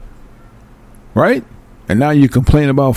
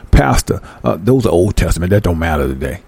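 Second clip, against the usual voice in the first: low mock objection, dropping lower; dismissive churchgoer; 7 semitones lower than usual. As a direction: lightly voice a churchgoer brushing it off.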